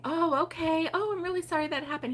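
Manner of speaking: imitated matter-of-course sympathy; polite sympathy. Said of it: "instead of saying".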